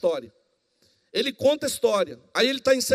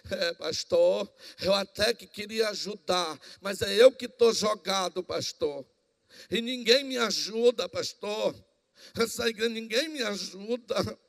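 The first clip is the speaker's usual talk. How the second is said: whiny church member; 2 semitones lower, slower than usual. Retold or imitated. Preacher mimicking a complaining churchgoer, slow whine. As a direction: moan like someone who always wants help. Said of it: "aquelas pessoas na igreja que diz".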